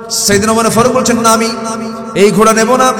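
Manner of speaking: high, loud fervent preaching; preaching at full force. Acted. Preacher urging the faithful, fervent shout. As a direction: preach it out to the crowd with fire.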